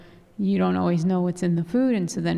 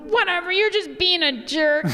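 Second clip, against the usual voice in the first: high, mock-annoyed snap; dismissive retort; 10 semitones higher than usual. Wife brushing off husband's spice excuse, mock annoyance. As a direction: act out a spat, pitch jumping up. Wife replays their argument.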